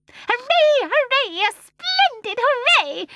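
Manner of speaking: very high, loud cheering; shrill cheer. A narrator's reading. Mrs Corrie dancing with delight, shrill cheering. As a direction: cheer for pure pleasure. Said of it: "in her shrill little voice".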